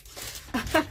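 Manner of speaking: airy little laugh; quick unvoiced chuckle. Real, not acted. Laughing at dropped flowers.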